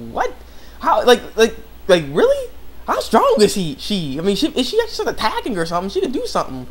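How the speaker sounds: high-pitched, animated disbelief; incredulous. Real, not acted. Fan questioning how strong she is, incredulous. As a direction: react baffled, voice jumping up high. Own incredulous reaction to plot.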